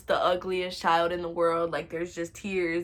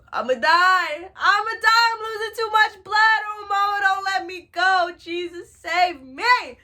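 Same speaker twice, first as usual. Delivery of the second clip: high, loud melodramatic wail; mock scared wailing; 12 semitones higher, 8 dB louder than usual. Bleeding child begging mama, hammed-up terror. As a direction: plead as if bleeding to death. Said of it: "I was so scared".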